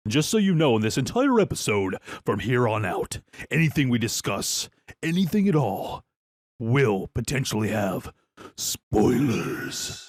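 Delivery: emphatic announcer-style disclaimer; big deliberate warning voice; spoiler warning. Acted. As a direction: announce it like an official warning, drawing out the last words.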